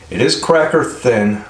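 chewing between words; talking with food in mouth; mouth full of pizza. Real, not acted. Seen 0: Pizza raised to mouth from 2.5s; earlier talking shot shows no food.

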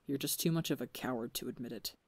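low, soft, quick contempt; cold jab. A narrator's reading. Technoblade breaking the silence, quiet cold contempt. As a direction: drop it quietly like a blade.

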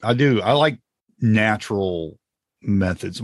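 normal talking voice

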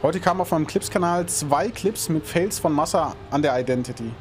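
ordinary talk, nothing marked

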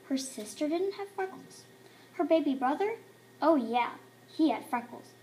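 plain reading voice

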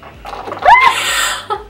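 breathy shrieking laugh. Real, not acted. Beauty blender dropped.

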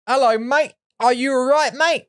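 high, hammed-up cheery British mate-talk; mock British accent. Mocking how Americans fake a British accent, high and hammed-up. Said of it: "the British accent is".

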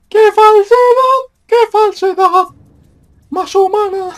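shrill mocking falsetto yell; mocking high shout. Real, not acted. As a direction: squeal it out high and loud. Mocking game's fake moment.

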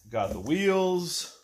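ordinary delivery

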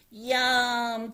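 high, loud, relishing exclamation; delighted cry. A narrator's reading. Froggy tastes the pasta, high delighted cry. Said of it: "cried Froggy".

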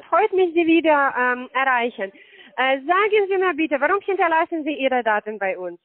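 ordinary talk, nothing marked

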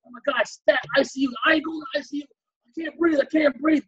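high-pitched, urgent emergency voice; acted panic. Patient refusing ICU while struggling to breathe, acted panic. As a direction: gasp out protests in a high panic.

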